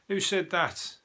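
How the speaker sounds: normal talking voice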